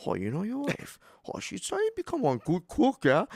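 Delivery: high, loud Hawaiian pidgin impression of dad; dad's local accent. Son mimics his dad praising his wife, in local pidgin. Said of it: "I'm impersonating him".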